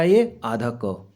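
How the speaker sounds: slow answer. Shown as a demonstration. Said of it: "क्या बन जाएगा?".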